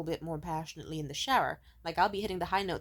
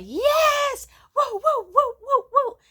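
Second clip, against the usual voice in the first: high, loud joyful screaming yes and woo; 12 dB louder, much slower than usual. Retold or imitated. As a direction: shriek for joy like you just won. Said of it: "I ran around my bedroom screaming".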